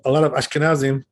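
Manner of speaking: normal talking voice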